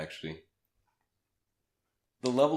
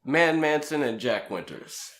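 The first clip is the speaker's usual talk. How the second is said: slow, proud name reveal, higher and louder; grand announcement; 6 semitones higher, 4 dB louder than usual. Acted. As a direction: announce the names like a big reveal.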